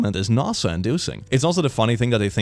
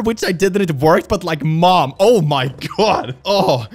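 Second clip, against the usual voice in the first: loud, high, exasperated disbelief; like, Mom, really?; 7 semitones higher, 6 dB louder than usual. Real, not acted. Scolding mom over bully revenge.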